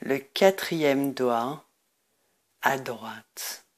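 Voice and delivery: guided meditation. Acted. Leads a Nidra Yoga meditation.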